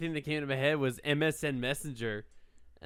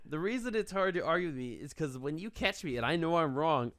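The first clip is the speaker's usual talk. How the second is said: ordinary talk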